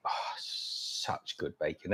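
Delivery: soft, slow whisper of delight; an unvoiced aside, savouring the taste; whispered relish. Real, not acted. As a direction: breathe it like a guilty pleasure. Savouring the bacon aloud.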